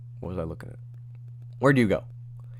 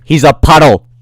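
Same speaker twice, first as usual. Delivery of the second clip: high, very loud repeat; exasperated shout; 6 semitones higher, 19 dB louder than usual. Real, not acted. Streamer answering a question already answered, exasperated shout. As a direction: blurt it at full volume.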